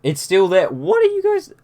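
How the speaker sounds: high-pitched disbelieving squeak; exasperated disbelief. Real, not acted. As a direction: voice jumps up in disbelief. Static persists after replug.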